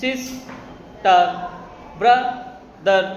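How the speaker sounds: very slow, a pause inside each word; syllable by syllable. Shown as a demonstration. Teacher giving examples of two-syllable words, syllable by syllable. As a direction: say "sister, brother" as সিস, টার, bra, দার. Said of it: "মা যখন উচ্চারণ করছে একবার".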